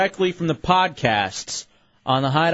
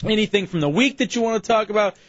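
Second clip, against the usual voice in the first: radio promo read; 4 semitones higher than usual. Acted. Promo read for call-ins.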